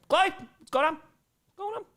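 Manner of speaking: high squeaky pet voice; pet talk. Real, not acted. Owner cooing at the dog Chloe, high pitched.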